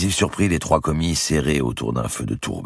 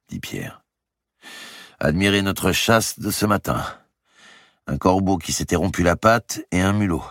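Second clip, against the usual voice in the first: a bit higher, wry and weary; lightly voiced young nobleman; 5 semitones higher than usual. A narrator's reading. Impoverished brother showing his poor hunt, wry. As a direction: voice him with rueful irony.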